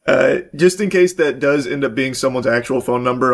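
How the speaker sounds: ordinary delivery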